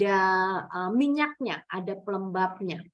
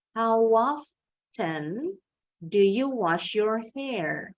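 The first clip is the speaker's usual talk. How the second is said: stretched-out question, dropping on "hair" like a full stop; falling question intonation; much slower than usual. Shown as a demonstration. Teacher models a WH question for pupils, falling intonation. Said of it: "kita mulai how-nya tinggi".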